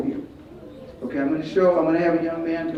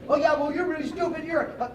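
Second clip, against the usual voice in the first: high-pitched, softer mock-angry comeback; acting mad; 10 semitones higher, 6 dB quieter than usual. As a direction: play at being riled by an insult. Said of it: "If you get mad".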